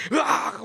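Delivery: loud, high scream jolting awake; startled scream. Retold or imitated. The grandfather wakes from the nightmare with a loud, high scream. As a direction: cry out as if ripped from a nightmare.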